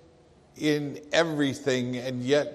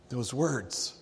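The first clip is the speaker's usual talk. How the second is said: softer echo; 6 dB quieter than usual. Acted. Stunned father echoing Jesus' words to himself, softly. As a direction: echo "those words" much softer, trailing away.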